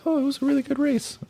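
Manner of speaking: high mocking falsetto; mock-gushing falsetto fan. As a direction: mimic gushing fans in a squeaky voice. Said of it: "they're like".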